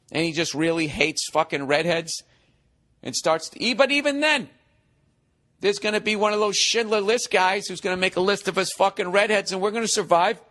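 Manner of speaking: normal talking voice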